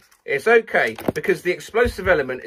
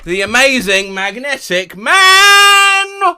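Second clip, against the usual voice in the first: slow, loud, higher hero announcement; grand announcer; 8 semitones higher, 6 dB louder, slower than usual. Pretend. Introducing himself to viewers, slow loud announcer. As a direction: boom it out like a circus announcer.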